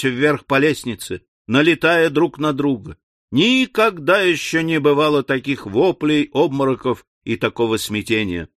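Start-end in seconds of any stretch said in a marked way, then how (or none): none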